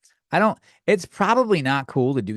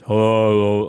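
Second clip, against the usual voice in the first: loud, put-on manly "oh"; overcompensated male voice; 3 semitones lower, 7 dB louder than usual. Mimicking an offended listener, overdone male voice. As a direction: bellow it in a fake male voice. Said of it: "overcompensated male voice".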